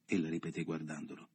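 plain reading voice

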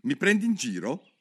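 furious yell. Acted. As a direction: fire it back in a fury. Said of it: "urlò il topo su tutte le furie".

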